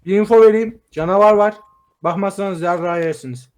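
loud urgent announcement; loud warning. Real, not acted. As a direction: shout the warning to the team. Warning friends of monster.

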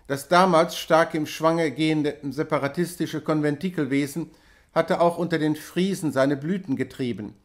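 usual speaking voice

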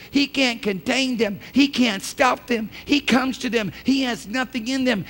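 high, building pulpit declaration; impassioned preaching. Real, not acted. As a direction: build each phrase towards a loud climax.

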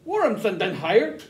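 high, pleading German; German accent. Acted. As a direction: protest nervously in German. Frantic German exchange.

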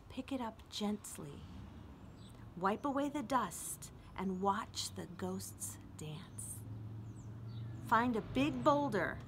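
ordinary talk, nothing marked

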